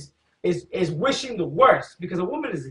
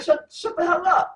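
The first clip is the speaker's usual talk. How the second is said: high mock woman's voice; wife mocking Tobit; woman talking back; 9 semitones higher than usual. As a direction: squeal it back at him. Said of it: "This bitch told Tobit".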